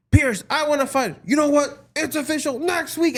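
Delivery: high mock wrestler promo voice. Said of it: "It's always the same thing".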